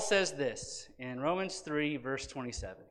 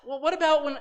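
inner objection; 10 semitones higher, 4 dB louder than usual. Retold or imitated. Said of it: "there's a little part of us that says".

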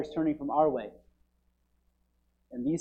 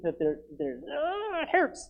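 mock pained cry; 11 semitones higher than usual. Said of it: "in pain".

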